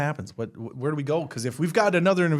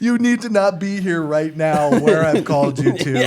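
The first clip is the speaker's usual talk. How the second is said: higher, louder comic protest; mock urgent warning; 4 semitones higher, 5 dB louder than usual. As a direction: act out an absurd warning, slightly hammed-up. Mock quote of the yelling character.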